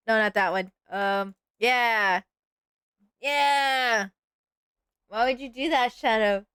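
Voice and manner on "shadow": ordinary delivery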